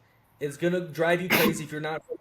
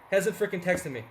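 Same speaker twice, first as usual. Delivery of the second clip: ordinary delivery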